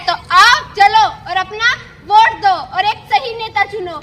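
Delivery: loud, driving lecture; forceful scolding. Acted. Woman urging a cynical voter to act, loud scolding. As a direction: take the doubter to task at full voice.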